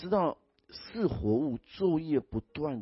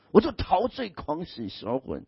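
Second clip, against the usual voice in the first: swooning rapture; 6 semitones higher, 6 dB louder, slower than usual. Retold or imitated. Acting the lovestruck heart.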